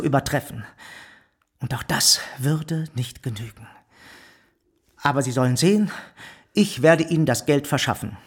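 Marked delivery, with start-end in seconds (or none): none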